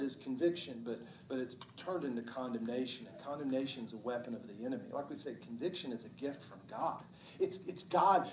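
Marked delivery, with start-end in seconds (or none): none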